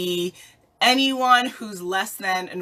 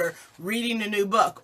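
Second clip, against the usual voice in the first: normal talking voice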